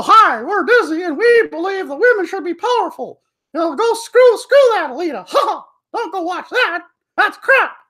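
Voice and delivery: very high, mocking corporate mascot voice; squeaky parody of a hypocritical company spokesperson; Disney. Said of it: "and Disney just like".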